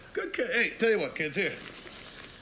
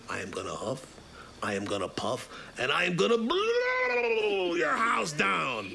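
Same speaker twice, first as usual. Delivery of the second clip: menacing wolf building to shout. Acted. Wolf threatens, classic huff puff.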